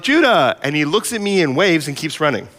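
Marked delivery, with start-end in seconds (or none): 0.0-0.6 loud call across the schoolyard. Retold or imitated. Father calling to son across the field. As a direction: shout his name to catch him. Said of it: "and I yell out".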